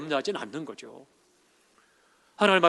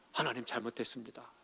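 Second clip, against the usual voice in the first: retold repentance; 4 semitones lower, 12 dB quieter than usual. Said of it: "회개가 터지더라고요".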